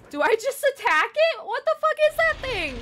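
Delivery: high, loud panicked shriek; frantic terror mid-fight. Real, not acted. Scorpion fight.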